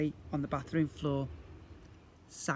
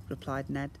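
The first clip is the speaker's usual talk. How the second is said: ordinary delivery, nothing marked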